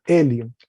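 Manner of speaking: "el león" said firmly, falling; teacher models the word; read out. Shown as a demonstration. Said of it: "primera persona".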